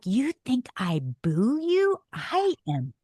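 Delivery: slow, emphatic, high-pitched protest; mock outrage, hitting each word; indignant. Wife defending herself to husband, indignant.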